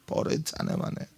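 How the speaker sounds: hushed tender whisper. Retold or imitated. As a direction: breathe it softly. Tender aside about wife. (نجوا)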